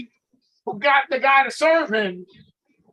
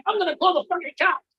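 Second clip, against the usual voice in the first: higher-pitched mock outrage; imitated angry threat; 5 semitones higher than usual. Woman threatening the process server at her door, mock angry.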